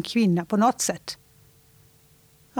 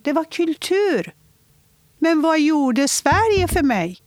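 higher-pitched, building in insistence; real impassioned hurt; 4 semitones higher than usual. Real, not acted. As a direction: hammer out each grievance, rising into a wounded accusation. Upprörd anklagelse om tvångsäktenskapet. (upprört och sårat, allt mer eftertryckligt)

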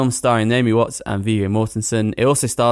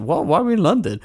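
higher, mock-baffled "why London?"; baffled surprise; 7 semitones higher, faster than usual. Recalling watching the trailer, mock-baffled surprise. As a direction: act puzzled at the film's location. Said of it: "I was like".

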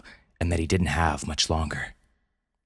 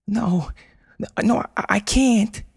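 high panicked protest; frightened pleading; scared refusal. Acted.

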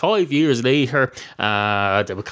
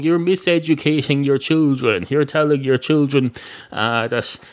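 ordinary talk, nothing marked

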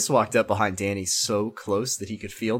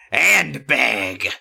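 louder mock-menacing growl; growly villain voice; 5 dB louder than usual. A narrator's reading. Chris taunts Danny on the phone, growly villain. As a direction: lean hard into a growly threat. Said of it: "less horrible voice".

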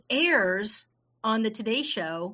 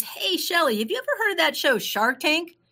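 much higher, eager question; perky friend; 8 semitones higher than usual. Retold or imitated. Friend asking about Shark Tank, high and eager. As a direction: imitate a friend's excited pitch. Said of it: "she reaches out to me".

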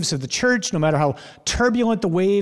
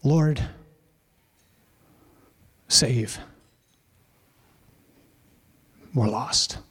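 Lord, save, we're lost, much softer and lower, with long pauses, fading to a breath; desperate hushed cry; 4 semitones lower, 3 dB quieter, much slower than usual. Shown as a demonstration. Preacher to congregation, voicing the disciples' plea, hushed and desperate. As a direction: let the plea sink into quiet desperation. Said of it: "it's three words".

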